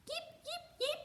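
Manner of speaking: very high, tiny chick peeps; high hatchling peeping; baby birds agreeing. A narrator's reading. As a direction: chirp it like hungry hatchlings. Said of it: "the babies agreed".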